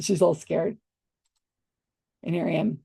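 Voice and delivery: normal talking voice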